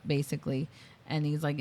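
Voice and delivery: ordinary talk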